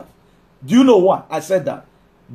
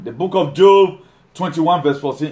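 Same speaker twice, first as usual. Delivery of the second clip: ordinary delivery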